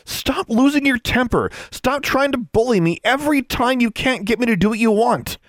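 high, loud scolding; angry shout. A narrator's reading. Sister with wand drawn on her bullying brother, angry shout.

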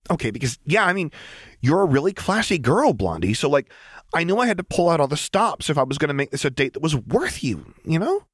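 put-on high, bouncy voice of a giddy girl; high-pitched eager tomboy; eager Jinx. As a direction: gush it out with nervous excitement.

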